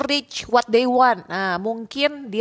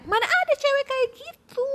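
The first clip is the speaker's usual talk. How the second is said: put-on high, scoffing girlish voice, much higher than own; mocking her disbelief. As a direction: sneer it out like the outraged date. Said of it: "Dia kayak".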